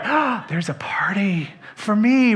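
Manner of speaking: a secret, delighted whisper to himself; hopeful whisper. Acted. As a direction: whisper it, hoping the party is his. Older son imagines party.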